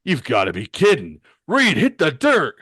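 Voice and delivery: loud character voice, rising to a yell; incredulous, then high urgent shout; Ben. Acted. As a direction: shout an alarmed warning to a friend. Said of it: "And Ben sees this and says".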